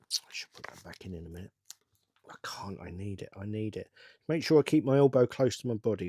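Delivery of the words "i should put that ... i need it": low, half-whispered self-talk; muttering. Real, not acted. Builder second-guessing himself over a piece, muttering. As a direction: mutter it under your breath.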